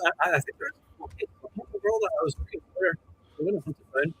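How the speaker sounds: muffled, like talking underwater; bad connection, muffled underwater sound. Real, not acted. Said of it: "you sound like you're underwater".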